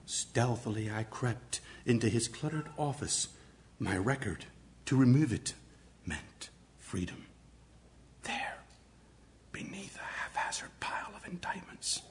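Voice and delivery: soft, hushed storytelling; a hushed whisper, creeping through an office; stealthy whisper. Shown as a demonstration. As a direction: sneak the words out in a whisper. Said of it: "Use intonation, varying pitch, volume".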